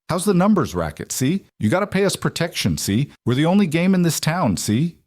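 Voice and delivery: mock tough guy demanding protection money; gangster impression. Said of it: "his own gangster impression".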